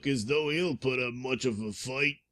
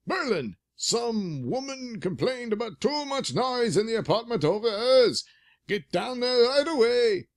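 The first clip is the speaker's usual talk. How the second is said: put-on high old-timer, dagnabbit; old coot voice; 11 semitones higher, 4 dB louder than usual. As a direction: cackle it like a grumpy old cop. McGinty orders Berlin.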